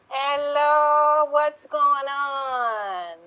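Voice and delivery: barge in calling loudly; the president's wife calling out from her margarita; bossy first lady. Acted. Improv, Missus President barges in.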